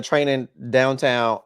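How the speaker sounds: usual speaking voice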